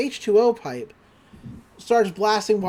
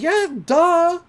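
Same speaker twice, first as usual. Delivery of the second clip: high, loud teasing retort; playful duh; 10 semitones higher, 5 dB louder than usual. Retold or imitated. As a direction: toss it off as obvious, imitating a friend. [name] confirms they are friends.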